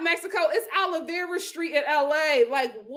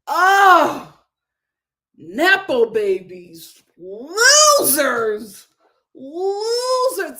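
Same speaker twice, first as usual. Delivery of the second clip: jeering insult; 8 dB louder, much slower than usual. Real, not acted. Jeering at Jordan Junior.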